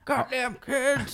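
put-on grumpy old man. Old man cursing the kid who tripped him, cranky voice. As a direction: do a cartoonish grumbling old man.

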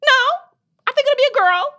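put-on high, loud little boy voice; imitating her son. Mom voicing her son's answer about marrying a girl. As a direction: play the kid answering sure of himself. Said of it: "He's like".

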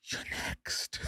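ominous breathy whisper; a grim warning, whispered very low. Retold or imitated. Whispering a paranoid warning to girlfriend mid-concert, ominous whisper. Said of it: "we're whispering this whole thing".

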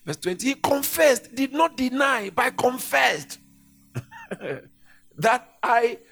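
loud, driving sermon delivery, pressing each phrase; preaching at full force. Acted. Preacher thundering John's confession to the church. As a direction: proclaim it over the congregation. Preaching John chapter one aloud.

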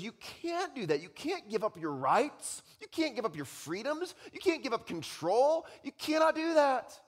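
high, incredulous objections; world's protest. Acted. Preacher voices the world defending rights, high incredulous protest. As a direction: pile up scandalised objections, pitched high. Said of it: "The world around you says".

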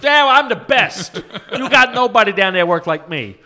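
very loud, high-pitched boasting; brash job seeker. Retold or imitated. Prank caller bragging to the hirer, brash. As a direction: bellow it as a cocky tough-guy caller. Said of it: "he was calling to get a job".

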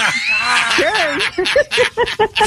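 high shouted burst of laughter; big whooping laugh. Real, not acted.

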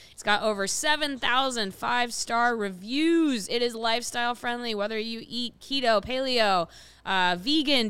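upbeat sponsor read. Acted. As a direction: sell it like a radio ad. Reads sponsor ad.

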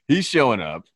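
amused, laugh bubbling through the question. Real, not acted. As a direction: talk while cracking up. Cracking up over the [name] prediction.

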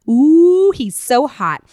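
put-on loud high ooh of a smitten teen; swooning. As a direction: swoon over him. Diary swoon.

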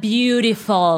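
slow, drawn-out gringo "Beautiful"; imitating a dazzled American; mock gringo awe. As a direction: savour it like an awed tourist. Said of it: "los gringos alrededor era".

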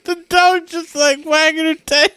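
ordinary talk

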